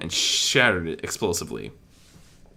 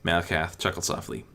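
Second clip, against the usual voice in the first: ordinary delivery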